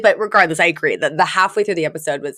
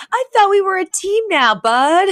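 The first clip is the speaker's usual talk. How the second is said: high put-on hurt voice, a bit louder; mock wounded pal, pitched up; deflated Cece; 13 semitones higher, 3 dB louder than usual. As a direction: squeak it out like a let-down friend.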